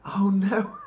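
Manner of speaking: high, loud dismay; horrified oh no. Retold or imitated. Viewer reacting to the body, high horrified dismay. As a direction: recoil at the sight. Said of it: "and you just go".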